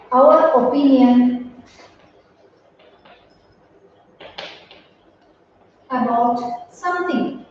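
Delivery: slow, with long pauses between chunks; dictation pace. Shown as a demonstration. Teacher dictating a definition to students, slow and paused.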